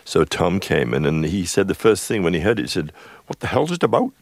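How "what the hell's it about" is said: put-on high-pitched, baffled Welsh accent. Singer hearing the song for the first time, Welsh accent. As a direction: imitate a puzzled Welshman, pitched well up. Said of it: "in his Welsh accent".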